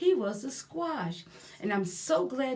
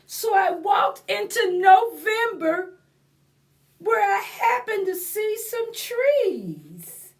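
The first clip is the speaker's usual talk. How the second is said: sad pumpkin voice; 9 semitones higher, 9 dB louder than usual. Acted. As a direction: read it as a sorry little pumpkin, pitched up. Continues held read voice.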